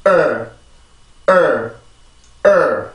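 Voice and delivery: 을 as a stressed English "er", not a Korean L; tense the throat, then release 을 as "er"; isolated "er" with a firm onset; stressed r-colored schwa. Shown as a demonstration. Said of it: "이렇게 강세를 만들어줍니다".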